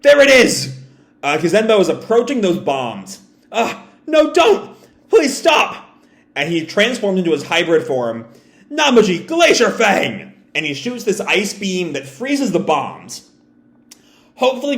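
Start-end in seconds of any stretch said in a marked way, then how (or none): 3.5-5.9 much higher, frantic begging; panicked plea. Retold or imitated. Yamato begging Kazenbo near the bombs, high panicked plea. 8.7-10.3 slow, higher battle cry; attack callout. Retold or imitated. Yamato transforming to freeze the bombs, slow battle cry.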